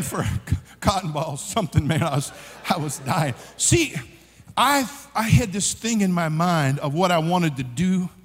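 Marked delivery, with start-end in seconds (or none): none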